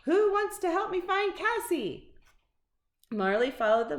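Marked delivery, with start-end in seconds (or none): 0.0-2.1 high, eager dog voice; bright call to the mice; excited bark-like question. A narrator's reading. Dog excitedly asking the mice for help. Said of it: "He barked excitedly as to ask".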